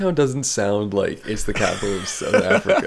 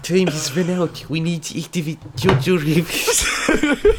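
slow, higher mock German with a laugh; mock German accent; 6 semitones higher, slower than usual. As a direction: declare it like a comic German villain. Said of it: "now you sound German".

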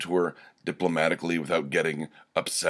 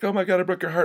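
high, shocked mock squeal; a mocking high squeal of dismay; shocked gasp; 12 semitones higher, faster than usual. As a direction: imitate her aghast overreaction, up high. Coworker overreacts to his joke.

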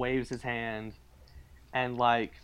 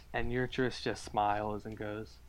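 ordinary talk, nothing marked

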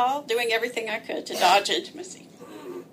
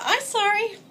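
put-on even higher baby voice; baby talk; 9 semitones higher, 3 dB louder than usual. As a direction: coo it like a toddler. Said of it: "spoken exactly like that".

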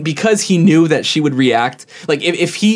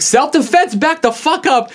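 imitated yelling; 13 semitones higher, slower than usual. Employee repeating his warning to the shover, mock yell. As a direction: retell his yell up high. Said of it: "he was just yelling".

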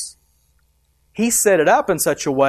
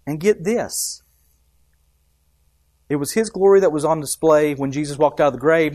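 usual speaking voice

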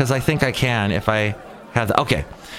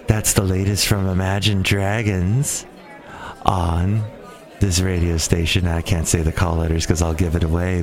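sexy DJ voice; 5 semitones lower than usual. Retold or imitated. Imitating a weekend DJ coworker, low fake sexy tones. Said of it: "this is how she talks".

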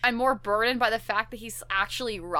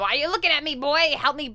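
put-on higher, louder old codger; old prospector voice; 5 semitones higher, 4 dB louder, faster than usual. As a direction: bark it like a cranky old-timer. Voicing grandpa.

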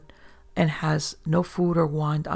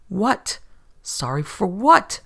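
slow, rising alarm; alarmed disbelief at the apology; alarmed question; 4 semitones higher, 6 dB louder, slower than usual. A narrator's reading. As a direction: demand it in disbelief. Sudden alarm at confession.

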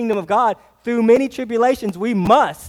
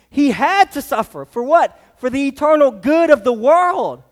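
preaching; 4 semitones higher, 4 dB louder than usual. Acted. Preaching climax.

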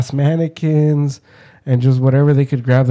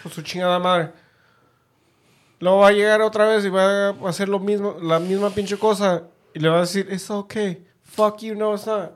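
high-pitched hammed-up scolding in Spanish; mock parental rant; 7 semitones higher, 3 dB quieter than usual.

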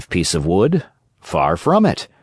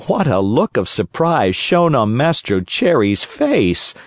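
ordinary delivery, nothing marked

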